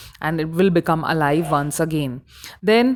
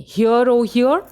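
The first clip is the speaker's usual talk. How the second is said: Hear and hear pushed higher and louder, rising; emphatic repetition; 4 semitones higher, 4 dB louder than usual. Shown as a demonstration. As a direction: call out the repeated hear for emphasis. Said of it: "This is the emphasis".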